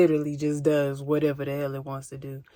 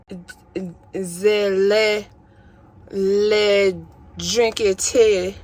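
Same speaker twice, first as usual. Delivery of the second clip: hammed-up posh accent drifting French; mock duchess accent; 6 dB louder, slower than usual. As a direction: teach tea manners like a fake duchess. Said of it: "It started off as a Duchess essence".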